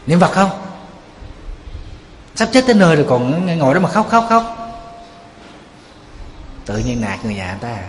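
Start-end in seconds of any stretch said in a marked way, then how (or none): 0.1-4.4 high, loud impatient scolding; an old Buddhist lady yelling at mourners; retold scolding yell. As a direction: snap at the grieving family to stop crying. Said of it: "la người nhà".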